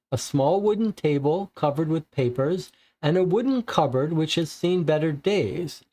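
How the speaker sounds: ordinary talk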